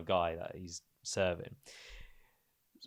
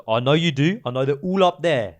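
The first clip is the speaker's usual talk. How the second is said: loud, raised insistence; angry insisting; 6 semitones higher, 10 dB louder than usual. Retold or imitated. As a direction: push back hard at the staff. Uncle insisting, getting angry.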